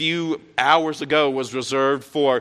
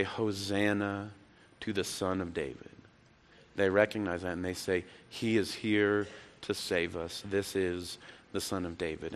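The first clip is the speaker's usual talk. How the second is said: put-on hushed children's praise; 3 semitones lower, 7 dB quieter than usual. Children praising Jesus in temple.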